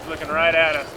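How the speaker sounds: normal talking voice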